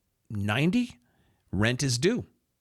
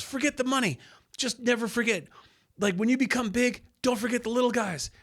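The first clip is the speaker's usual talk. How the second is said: much higher put-on voice for the driver; driver's voice; 12 semitones higher than usual. Driver telling the young DJ to remember him, high put-on voice. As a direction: voice the driver, pitched way up.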